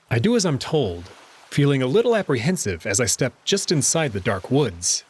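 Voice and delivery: usual speaking voice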